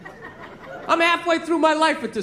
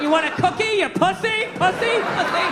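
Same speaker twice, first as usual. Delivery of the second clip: high-pitched taunting call, rising questions; mock yelling across a restaurant; 7 semitones higher than usual. Counterman calling across the restaurant, mock-yelled taunt. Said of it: "yell it across the restaurant".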